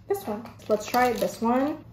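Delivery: ordinary talk, nothing marked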